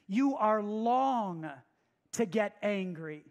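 "long" stretched out and leaned on, very slow; stress on long. Shown as a demonstration. Preacher explaining the Hebrew word to the church, stretching "long". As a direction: draw out "long" to show the Hebrew meaning. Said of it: "a Hebrew word that means to be long".

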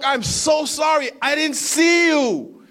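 shouted apology, high and loud, slowing on the last words; panicked apology. Retold or imitated. Driver apologizing to the man he nearly hit, panicked shout. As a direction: blurt it out, shaken and loud. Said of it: "And I'm like".

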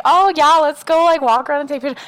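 high, loud hammed-up cheerful suggestion; mock eager boyfriend. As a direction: play the eager boyfriend, overdoing it. Boyfriend voiced suggesting a walk.

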